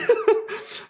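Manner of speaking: airy strained giggle; breathless laugh. Real, not acted. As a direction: laugh through the effort.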